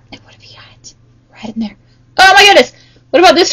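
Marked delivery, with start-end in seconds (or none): 0.1-1.8 hushed secret whisper. Pretend. Teacher to the kids, hiding a turtle: whispered. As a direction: whisper as if not to spoil the hiding.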